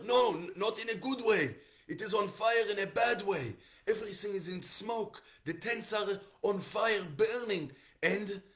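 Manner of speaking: high, loud frantic correction; hammed-up high-pitched urgency; panicked messenger. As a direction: insist in alarm that it's a disaster.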